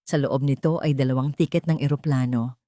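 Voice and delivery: ordinary delivery, nothing marked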